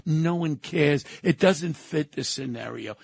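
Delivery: normal talking voice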